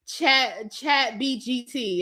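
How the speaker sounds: slow "Chat B G T" with B and G swapped in; mom's mispronunciation. Retold or imitated. To her viewers, copying mom's slow Chat B G T. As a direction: spell out "Chat B G T" carefully wrong. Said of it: "that's how she says it".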